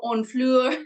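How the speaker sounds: "Honfleur" in an attempted French accent; self-conscious American go at French; French pronunciation. Shown as a demonstration. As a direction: put on a playful French "on-FLUR". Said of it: "I feel so funny saying all this".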